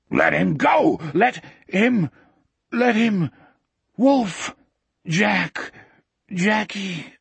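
high, broken-up cries of alarm; desperate cries. A narrator's reading. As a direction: cry out in separate bursts, pitched high. Struggling friends cry out in rage.

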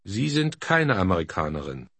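usual speaking voice

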